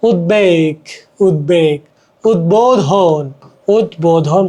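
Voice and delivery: উদ্বেগ as "ud-beg", low and deliberate; slow, low উদ্বোধন with the b kept, "ud-bo-dhon"; audible b. Shown as a demonstration. Teacher illustrating the kept b, slow উদ্বেগ, উদ্বোধন. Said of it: "ব বাংলা উচ্চারণ লুপ্ত হয় না".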